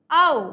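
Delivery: loud, carefully articulated आओ with rounded lips; vowel demo. Shown as a demonstration. Teacher showing students the vowel sound, loud and clear. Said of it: "next आता है ऊ".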